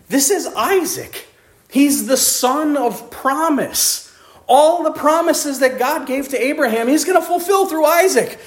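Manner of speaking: high-pitched, baffled objection; incredulous protest. Acted. Preacher objects that Isaac is the promised son, incredulous. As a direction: build from startled stammer to high, insistent disbelief. Acts out disbelief at God's command.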